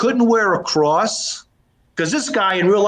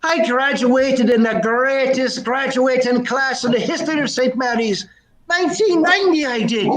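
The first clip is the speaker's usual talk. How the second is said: proud Dubliner, pitched up; Irish brogue; 6 semitones higher than usual. Acted. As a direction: boast in a high Irish brogue. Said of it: "a bit of a brogue".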